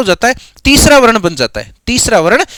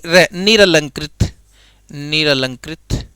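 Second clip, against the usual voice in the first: निरलंकृत drawn out, the second take softer; slow dictation; 5 semitones lower, 4 dB quieter, slower than usual. Shown as a demonstration. Teacher to students, correcting र and dictating slowly. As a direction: stress the short र in निरलंकृत slowly. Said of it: "रा नहीं".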